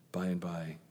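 barely whispered ending. Real, not acted. Preacher closing on eternal life, whispered. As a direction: let it fall to a whisper. Hushed close.

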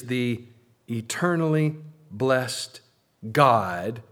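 slow and deliberate, each word set apart; slow, careful reading. Real, not acted. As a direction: pause before the final word and land it firmly. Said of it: "I'm reading this slowly and carefully".

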